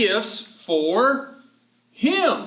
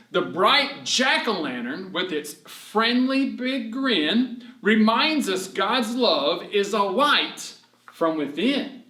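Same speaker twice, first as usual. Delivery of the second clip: poem reading; 3 semitones higher, slower than usual. Acted. Reads rhyming verse.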